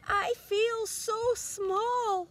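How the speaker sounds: high, slow, small snail voice; tiny snail voice. A narrator's reading. Snail tells the whale, high and slow in awe. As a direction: sound tiny and awed, very slowly. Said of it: "she said to the whale".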